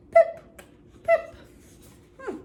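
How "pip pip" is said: very high, loud little pip; goldfish kiss. Shown as a demonstration. Teacher shows kids a goldfish kiss with a squeaky pip. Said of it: "make a noise like a goldfish kiss".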